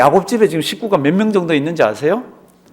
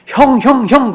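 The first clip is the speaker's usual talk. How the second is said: higher, eager calling of hyung; pestering little brother; 7 semitones higher, 4 dB louder, slower than usual. Retold or imitated. Young Joseph calling his older brothers, eager and high. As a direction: tug at them like a pesky younger brother.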